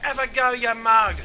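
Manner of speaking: high, loud mock heckle from the stands; Aussie heckle. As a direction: yell it like a rowdy cricket fan. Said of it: "And what about".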